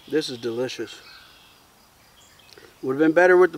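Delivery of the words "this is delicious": softer, talking with mouth full. Real, not acted. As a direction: mumble through a mouthful of food. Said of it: "Apologize for talking with my mouth full".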